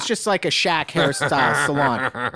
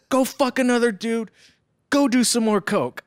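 mock shouted insult, pitched up; angry yell; 6 semitones higher than usual. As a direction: fling it at the departing boyfriend. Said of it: "And he goes".

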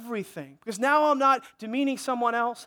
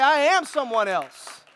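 declared boldly; 3 semitones higher, 6 dB louder, slower than usual. Shown as a demonstration. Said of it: "That's what you need to say".

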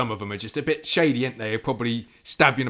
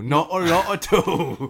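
laughing; 3 semitones higher, much slower than usual. Acted. Repeating joke about small pay.

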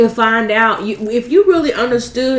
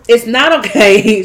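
ordinary talk

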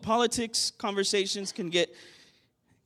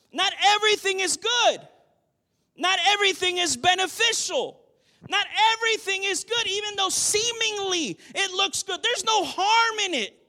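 full-force preaching; 10 semitones higher than usual. Acted. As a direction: preach it hard to the church.

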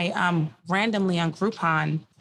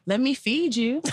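mock date offer; 6 semitones higher than usual. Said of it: "If he's like".

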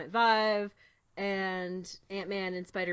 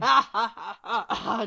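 loud, spaced-out mock laughter; imitated belly laugh; 2 semitones higher, 7 dB louder than usual. Fan imitating Chris Evans laughing in interviews, exaggerated ha-ha laugh. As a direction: mimic an actor's big laugh. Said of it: "time he laughs".

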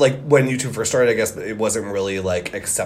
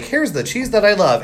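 mock ad pitch; 9 semitones higher, 4 dB louder than usual. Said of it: "you'd have to be like".

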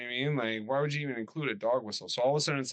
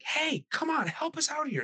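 much higher, pleading horror-movie extra; pleading movie character. Acted. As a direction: beg him for help in a high voice.

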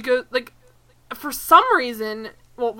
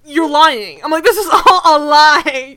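louder and higher, mock fury at the guard; mock outraged retort; 4 semitones higher, 10 dB louder than usual. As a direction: yell at the screen in fake outrage. Arguing with the TV guard.

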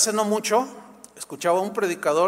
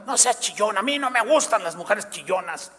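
mock scolding husband. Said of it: "en lugar de regañarla".

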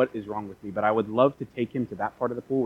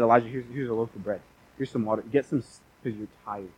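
soft, lower, tender offer; gentle; 2 semitones lower, 5 dB quieter than usual. Retold or imitated. God to tired Elijah, offering bread and water, gentle. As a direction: coax a weary person kindly. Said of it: "God treats us so gently".

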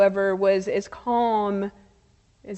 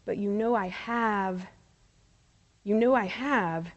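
hushed, lower admission; quiet confession; 3 semitones lower, 6 dB quieter than usual. Retold or imitated. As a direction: say it softly, as a subdued admission. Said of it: "he said quietly".

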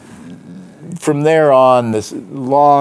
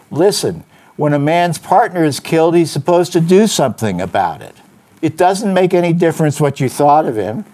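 higher, louder, insistent; forceful lecture; 7 semitones higher, 4 dB louder than usual. Acted. Detective laying down his code to Brigid, forceful and insistent. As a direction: hammer home every reason.

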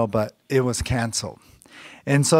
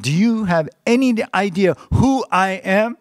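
retold, much higher and indignant; loud demand; 6 semitones higher, 3 dB louder than usual. Angry passenger demanding at the airline counter, loud. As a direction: bark it like an entitled VIP. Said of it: "he demanded in this loud voice".

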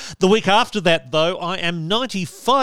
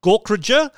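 Gorkrodger tried with the first syllable high, as a question; stress test; 7 semitones higher than usual. Shown as a demonstration. Host testing Dave's surname, stress on Gork. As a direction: try Gorkrodger stressed up front with a rising question. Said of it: "Where is the emphasis on the syllables?".

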